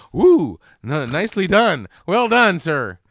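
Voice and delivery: loud, high admiring whoop; impressed cheer. Retold or imitated. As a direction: cheer the driver on.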